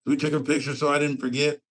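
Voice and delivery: ordinary talk, nothing marked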